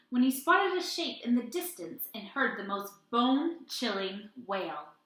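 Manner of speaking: put-on lively read-aloud to children; animated children's story reading; storytime narration. A narrator's reading. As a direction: tell it like a picture book at storytime. Reads picture book aloud.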